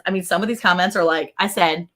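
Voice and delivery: ordinary delivery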